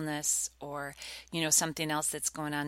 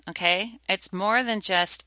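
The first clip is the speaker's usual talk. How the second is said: ordinary talk, nothing marked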